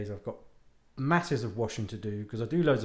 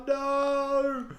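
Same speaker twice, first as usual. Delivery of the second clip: dismayed; 11 semitones higher than usual. Retold or imitated. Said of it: "I'm like".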